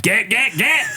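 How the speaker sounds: very high staccato squeals; high cartoonish hype voice; put-on character voice. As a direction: yelp it in a silly rising squeak. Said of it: "I just love doing the voice".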